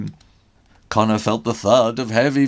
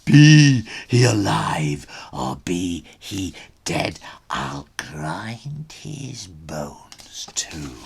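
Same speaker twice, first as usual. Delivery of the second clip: menacing ogre whisper; 7 semitones lower, 3 dB louder, slower than usual. Acted.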